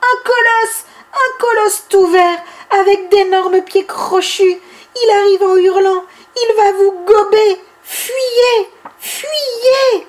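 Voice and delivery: high, loud fake panic; mock alarmed shout. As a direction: shout a made-up warning about a colossus. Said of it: "Bibi se remet à crier". (crier une fausse alerte, aigu et fort)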